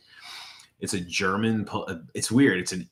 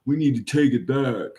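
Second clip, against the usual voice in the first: a loud, higher, blustering pundit voice; lightly voiced blowhard making a claim; mock dumbass pundit; 4 semitones higher, 9 dB louder than usual. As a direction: ham up a bombastic talking head. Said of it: "some dumbass is like".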